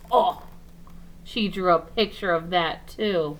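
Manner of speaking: usual speaking voice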